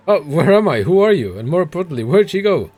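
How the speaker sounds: confused NPC voice. Acted.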